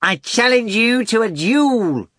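high, slow loud cat challenge; bellowing. A narrator's reading. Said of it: "bellowed the cat".

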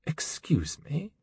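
cold, slow whispered threat; menacing whisper. Acted. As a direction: breathe it out icily, barely voiced. Wolf's chilling response.

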